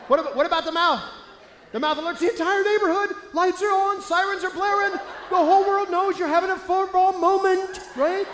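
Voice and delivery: loud, high and brash; brash, booming mouth personality. Acted. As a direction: blare it like an alarm. Acting the loud mouth type.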